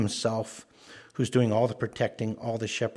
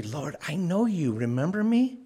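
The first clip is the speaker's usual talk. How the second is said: higher, rising pleas, rising to the end; an anxious man begging to be recognized; pleading claimant; 6 semitones higher than usual. Retold or imitated. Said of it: "He's going".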